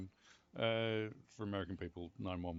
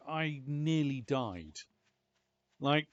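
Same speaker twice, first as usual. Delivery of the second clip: usual speaking voice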